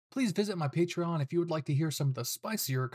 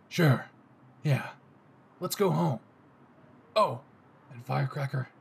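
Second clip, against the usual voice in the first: low, smug possessive tenderness; a hint of a satisfied yandere; lightly voiced Bakugo; 4 semitones lower than usual.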